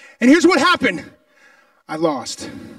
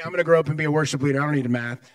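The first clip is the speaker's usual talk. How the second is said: mock careless student; 6 semitones lower, much faster than usual.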